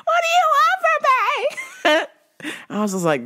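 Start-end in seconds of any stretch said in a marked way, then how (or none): 0.0-2.1 very high, hammed-up movie scream; movie-style desperate scream. Imitating Jennifer Love Hewitt spinning in the street, high mock scream. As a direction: wail it like a horror heroine.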